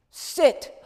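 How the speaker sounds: loud firm command to sit; stern order. Retold or imitated. Otto ordering angry Miep to sit, loud stern command. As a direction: bark the order down.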